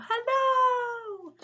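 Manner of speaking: high, drawn-out loud call; long ringing "hello" shout; hollering. Shown as a demonstration. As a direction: holler it like calling across a field. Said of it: "put your hands around and go".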